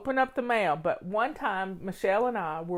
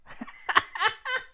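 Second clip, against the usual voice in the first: high delighted laugh. Real, not acted. Telling viewers about meeting the Ottawa clerk, high laugh. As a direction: burst of high laughter at the coincidence.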